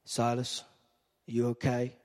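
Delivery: lightly voiced caring Paul; a hint of a worried friend checking in; gentle Paul. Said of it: "Paul's like".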